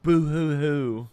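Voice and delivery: mock sobbing; hammed-up boo hoo crying; fake crying. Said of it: "he's crying".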